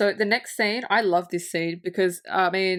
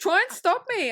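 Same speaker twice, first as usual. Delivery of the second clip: much higher, hammed-up desperate voice; mock frantic demand; 11 semitones higher than usual. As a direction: plead in comic panic to be stopped. Acts out Hook's frantic demand.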